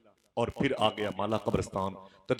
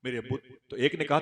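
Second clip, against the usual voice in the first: normal talking voice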